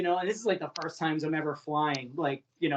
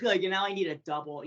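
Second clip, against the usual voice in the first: ordinary talk, nothing marked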